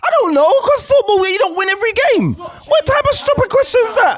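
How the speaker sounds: high-pitched, exasperated shouting; outraged retort. Real, not acted. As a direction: fire back at a question you find ridiculous. Outraged answer to why they lost.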